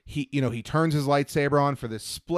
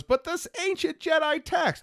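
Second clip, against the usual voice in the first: much higher, pleading mock Luke; whiny Luke. Luke protests to Yoda, pitched way up and whining. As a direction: squeak it out like a protesting student. Mimicking Luke's whiny line.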